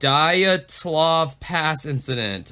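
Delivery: ordinary talk, nothing marked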